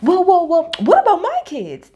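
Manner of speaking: high, loud indignant cry; mock indignant Jen. Mimicking Jen cutting in about her own kids, high and loud. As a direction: butt in loudly about your kids. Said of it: "here comes Jen".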